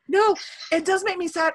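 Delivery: ordinary talk, nothing marked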